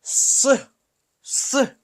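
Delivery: "S" hissed high as a long unvoiced "shch"; teacher sounding out a letter; Russian hushing sound. Shown as a demonstration. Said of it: "And the next is your".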